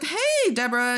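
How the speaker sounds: high, bright call of hello; cheery greeting. Retold or imitated. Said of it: "I was like".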